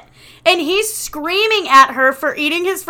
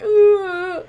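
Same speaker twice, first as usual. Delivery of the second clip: high tearful moan; mock sob; 7 semitones higher than usual.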